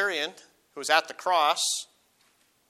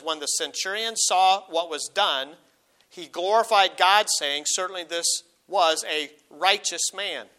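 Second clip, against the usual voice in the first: slow, louder pulpit reading; slow, swelling proclamation; scripture proclaimed; 2 semitones higher, 4 dB louder, slower than usual. Acted. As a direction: draw it out with weight. Reads Luke's centurion account.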